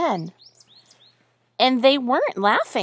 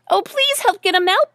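high, loud, urgent plea; begging mother duck; 9 semitones higher, 5 dB louder than usual. A narrator's reading. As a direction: beg for help as a worried mother, pitched up. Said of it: "begged the duckling's mother".

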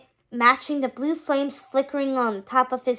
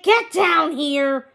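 raised, drawn-out summoning yell; angry yell; 4 semitones higher, 5 dB louder, slower than usual. A narrator's reading. Hades summons Pain and Panic, yelling. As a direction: yell for underlings, higher, louder and slower. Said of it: "Hades yelled".

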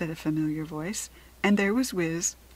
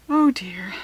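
mild sighed "oh dear", louder onset; a touch of weary dismay; sighing dismay; 3 semitones higher, 10 dB louder than usual. A narrator's reading. Jessica regrets the shot hare, sighing in dismay. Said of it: "Jessica sighed".

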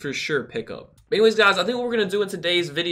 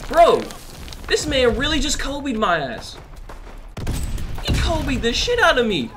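much higher, incredulous gamer complaint; exasperated disbelief; 8 semitones higher, slower than usual. Real, not acted. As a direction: protest in high-pitched disbelief at being killed. Exasperated at getting killed in game.

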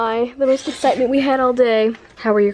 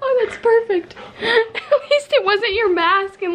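laughing; 12 semitones higher than usual. Real, not acted. Laughing at the sneeze.

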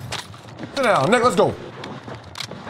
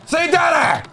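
higher-pitched taunting shout; triumphant taunt; 5 semitones higher than usual. Real, not acted. Gamer crowing over a beaten opponent, triumphant taunt. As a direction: shout it as a victor's dare. Taunting after winning a fight.

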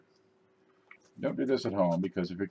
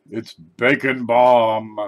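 drawn out, much louder, very slow; excited; 3 semitones higher, 10 dB louder, much slower than usual. Real, not acted. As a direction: savour it like a prize, loudly. Unboxing a novelty bacon balm.

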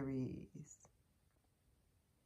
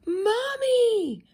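very high, loud joyful call; happy duckling quack; 7 dB louder than usual. A narrator's reading. Duckling spots Mother Duck, quacking happily. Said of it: "quacked the little duckling happily".

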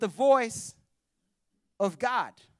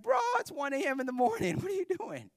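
higher put-on voice of a grumpy old priest; sleepy old Eli; 8 semitones higher than usual. Old Eli woken at one AM snaps at the boy, high and put-on. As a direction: play the woken old man, annoyed and amused. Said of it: "He's like".

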